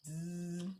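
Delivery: soft, imitated bzz; bee buzz. A narrator's reading. The bee buzzes near the donkey's ears, soft buzz. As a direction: hold a quiet buzzing z.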